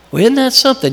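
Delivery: high, wry surprised aside; a touch of surprise, pitched high; mock surprise. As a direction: act caught off guard, amused. Retold surprised thought.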